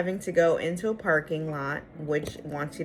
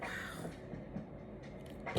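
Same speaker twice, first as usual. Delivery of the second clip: retold barely audible amazement; stunned whisper; 18 dB quieter than usual. Finding the salon in the basement, stunned retold whisper. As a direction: whisper it to yourself, very softly.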